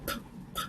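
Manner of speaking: p, p said unvoiced, just puffs of air; whispered puffs of p, p; breathy p sound. Shown as a demonstration. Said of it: "first sound".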